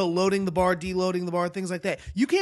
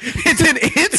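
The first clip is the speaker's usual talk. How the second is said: slow, laughing disbelief; 11 semitones higher, 8 dB louder, much slower than usual. Real, not acted. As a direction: giggle the question out. Laughing incredulous question.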